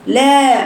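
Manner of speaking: لا held long and even; two-count madd. Shown as a demonstration. Teacher showing the length of the madd, vowel held. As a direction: stretch the a of لا for two beats. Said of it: "حركتين".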